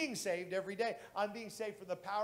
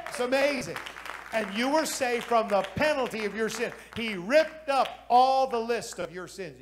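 building preaching; 3 semitones higher, 9 dB louder than usual. Acted.

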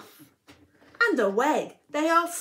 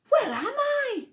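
1 amazed question; 12 semitones higher than usual. Acted. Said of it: "he said".

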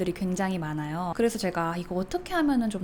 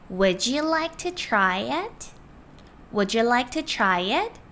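slow, rising polite question; slow polite offer; much slower than usual. Shown as a demonstration. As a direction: offer it gently to a customer. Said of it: "이 문장 하나로 가능합니다". (손님에게 천천히 권하는 말투)

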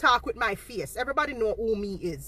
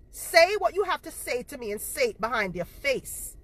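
ordinary talk

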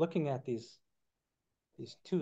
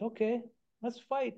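put-on much higher, eager little-girl voice; little girls agreeing; 9 semitones higher than usual. Little girls agreeing to Coyote's dare, high childish voice. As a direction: chirp it like small girls keen to play. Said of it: "they're little girls".

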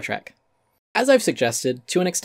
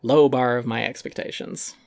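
normal talking voice

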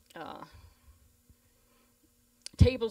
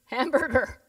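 louder, pressing the word; louder insistent call; 5 semitones higher, 10 dB louder than usual. Retold or imitated. Preacher retelling repeating the word to a silent crowd, louder insistent call. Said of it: "So he said it again".

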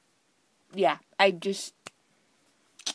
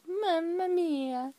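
mock Italian; 8 semitones higher, slower than usual. Playful Italian exclamation.

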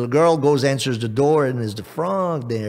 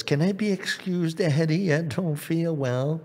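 put-on high little-girl plea; acted poorly princess wanting to be excused; pleading daughter; 5 semitones higher than usual. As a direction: ask Daddy in a small, high voice. Princess begs to leave table.

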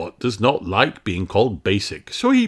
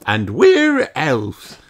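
proud cheer; 6 semitones higher, 6 dB louder, much slower than usual. A narrator's reading. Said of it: "cheer Ben and Barnaby proudly".

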